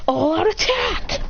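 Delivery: high, drawn-out charge call; battle command. Acted. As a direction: stretch the order upward. Hero orders everyone to attack.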